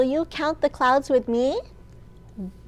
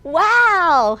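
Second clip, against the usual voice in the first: higher, louder gasp of wonder; owl's wow; 6 semitones higher, 7 dB louder than usual. Acted. Owl sees the dawn sky, a high, loud wow. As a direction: owl can't believe the pink sky. Owl exclaims in picture book.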